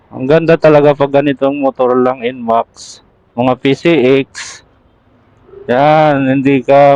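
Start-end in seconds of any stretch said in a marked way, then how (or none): none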